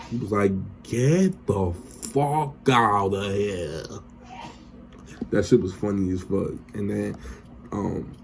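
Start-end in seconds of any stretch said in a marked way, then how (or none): 0.8-3.8 very slow, stretched-out imitation; drawn-out mock dismissal. Tommy sending Two Bit off, drawn-out and dismissive. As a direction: drag every word out for comic dismissal.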